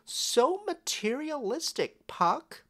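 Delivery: high, sing-song imitation of a scolding; mock scolding. Puck mocking how Oberon and Titania scold him, high mock scolding. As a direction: mimic the couple nagging him. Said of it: "They scold me".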